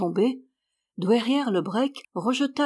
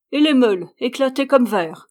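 defiant reply; 4 semitones higher, 7 dB louder than usual. A narrator's reading. Old woman's defiant reply continues.

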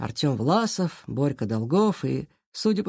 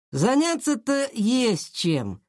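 slow, high drawl; offhand taunt; 6 semitones higher, much slower than usual. A narrator's reading. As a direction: draw it out, lofty and unbothered. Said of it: "пожал плечами Власов". (протяжно, с ленцой)

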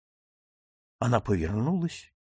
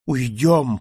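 plain reading voice

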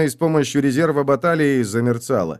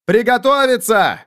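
high, raised battle command; shouted order; 12 semitones higher, 4 dB louder than usual. A narrator's reading. Prince orders his army to get ready, shouted command. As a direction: call out the order across the ranks. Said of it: "отдал приказ принц".

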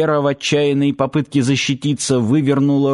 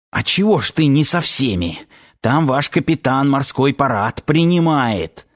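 plain reading voice

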